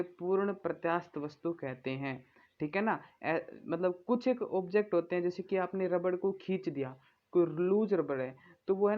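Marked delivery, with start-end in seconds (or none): none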